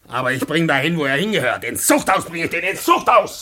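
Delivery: a father's rage against the informer, ending in a shout; furious threat. Acted. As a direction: swear to throw him in prison, building to a yell. Father rages, threatens prison.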